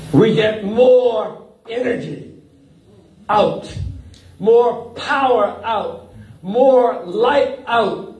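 deliberate preaching cadence, stretched out; slowing preacher build. Acted.

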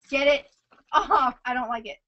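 ordinary talk

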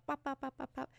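high, quick run of pops; playful sound effect for an entrance; imitated popping noise. Shown as a demonstration. Imitated noise as Alia rolls up.

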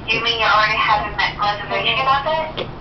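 normal talking voice

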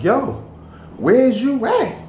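high, drawn-up mock voice; put-on high voice; high-pitched retold call. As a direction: imitate him calling out in a high pitch. Retelling brother-in-law's phone call.

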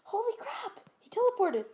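high shocked yelp; startled. Real, not acted. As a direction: caught off guard. Animatronic suddenly moved.

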